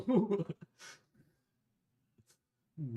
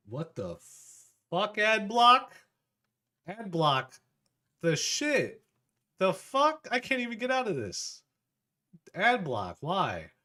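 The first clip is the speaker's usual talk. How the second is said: much higher and louder, shocked at the pop-up; startled outburst; 9 semitones higher, 8 dB louder than usual. Real, not acted. Streamer hit by a sudden ad, shocked and loud. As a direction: blurt it out in disbelief.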